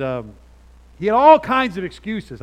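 ordinary talk

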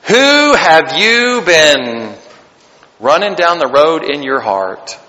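very slow, low, drawn out; preacher probing hearts, slow and low; slow searching question. Acted. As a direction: let the question sink in.

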